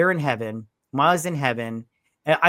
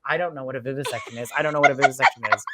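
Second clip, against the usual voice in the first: fast, rattled repetition; rushed panic; much faster than usual. Retold or imitated. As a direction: rush it in mock panic. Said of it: "I was like".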